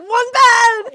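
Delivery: mock-outraged shout, high and loud; written in capitals. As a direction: yell it like all caps. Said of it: "look at it in capitals".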